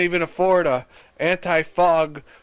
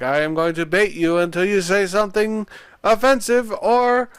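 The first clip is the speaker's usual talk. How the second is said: higher-pitched mock French accent; 6 semitones higher than usual. Host mimicking Spiro baiting John, French accent. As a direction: put on a careful French accent. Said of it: "it's like a French accent".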